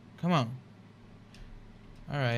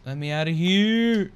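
loud, higher cry to escape; frustrated yell; 6 semitones higher, 11 dB louder than usual. Real, not acted. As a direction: yell to be let out. Stuck in game, frustrated.

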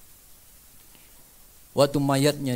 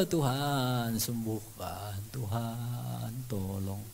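hushed prayer; 8 dB quieter, much slower than usual. Retold or imitated. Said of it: "Aku berdoa".

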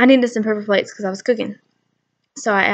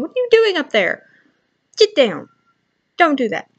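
scolding; 6 semitones higher, 5 dB louder than usual. Retold or imitated. Scolding kids climbing things.

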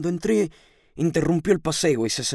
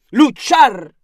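loud, high exhortation; rousing command; 6 semitones higher, 9 dB louder than usual. A narrator's reading. Landlady urging the lodger to fight, forcefully. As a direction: call it out as a battle cry.

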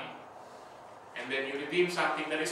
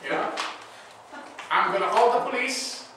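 louder and higher, a hammed-up indignant tirade; mock indignant outburst; 5 semitones higher, 8 dB louder than usual. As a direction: play the outraged boyfriend telling the kidnappers off, loud and mocking. Pastor acts his dismissive reply.

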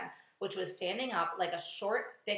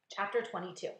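plain reading voice

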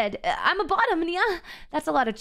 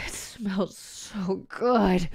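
blissful sigh; 8 semitones lower, 4 dB quieter, much slower than usual. Pretend. Blissful sniff of boy scent.

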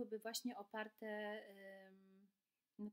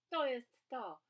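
excited cry; 3 semitones higher, 12 dB louder, slower than usual. Acted. Boar praises soup.

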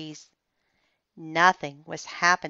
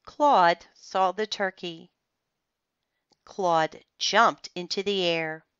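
usual speaking voice

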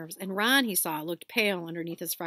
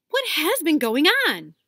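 put-on higher, louder scolding girl; bossy girl; 8 semitones higher, 10 dB louder than usual. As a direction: demand answers, indignant. Hermione bursts in, shocked.

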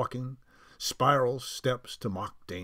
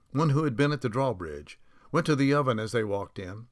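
ordinary delivery

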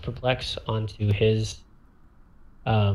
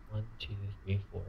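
much softer, muttered count; barely audible tally of squares; counting under the breath; 15 dB quieter, faster than usual. Real, not acted. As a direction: count quietly to yourself.

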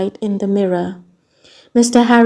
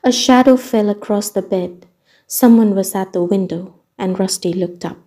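plain reading voice

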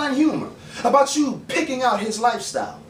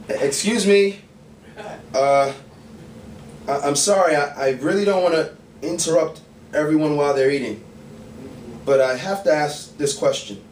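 halting, apologetic public announcement; mock polite interruption; 5 semitones lower than usual. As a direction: address the room haltingly, asking for attention. Mock interruption of people eating.

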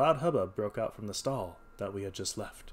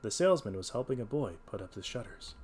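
plain reading voice

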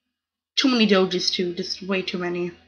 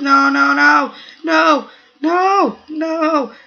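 high, loud panicked no's; panicked shouting; 4 semitones higher, 4 dB louder, slower than usual. Real, not acted. Gamer panicking as his cells get eaten, loud shouts. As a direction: shout in despair as you lose.

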